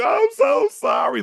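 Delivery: very high, slow, wailing cry of sorry; tearful preacher apology. Retold or imitated. Cheating dad wailing apology like a TV preacher. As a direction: wail it like a televangelist caught out. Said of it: "looking like Jimmy Swaggart".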